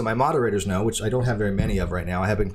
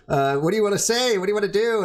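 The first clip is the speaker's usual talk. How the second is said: higher-pitched imitation of a questioning co-host; Cheshire; 11 semitones higher than usual. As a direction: mimic her pressing questions, pitched well up. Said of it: "She's like".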